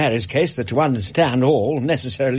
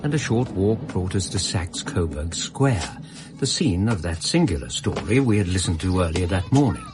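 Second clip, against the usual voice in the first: measured, reflective storytelling voice; story narration; 6 semitones lower than usual. A narrator's reading. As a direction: recount it like a memoir read aloud.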